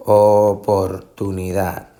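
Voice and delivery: slow, measured "Oportunidad"; drawn out by syllable. Shown as a demonstration. Teacher showing how a word divides, drawn out slowly. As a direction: stretch out each syllable of "Oportunidad". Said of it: "Esto es cómo se divide en sílabas".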